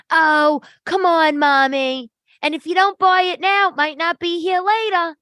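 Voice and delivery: loud, very high mock saleswoman; pushy sales girl. Sales girl presses mommy to buy now, high pushy mimicry. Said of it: "Sales girl comes over and she's like".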